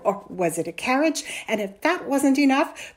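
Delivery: loud, exasperated scoff; mock the tall tale. A narrator's reading. Mother doubts her son's story, scoffing.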